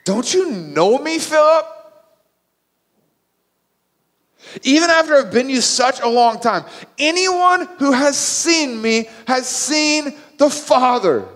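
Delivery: high, loud, impassioned rebuke; exasperated, full-voiced preaching; emotional exchange. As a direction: plead forcefully with a follower who doesn't get it. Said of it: "this emotional exchange".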